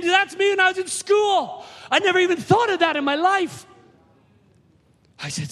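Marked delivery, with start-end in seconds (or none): 0.0-3.7 retold scream. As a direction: mimic her shriek of recognition. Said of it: "and she screams again".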